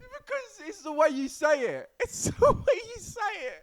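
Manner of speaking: high, loud protest through laughter; helpless laughter breaking up the words; laughing protest. Real, not acted. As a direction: squeal it out while cracking up. Cracks up at co-host's innuendo.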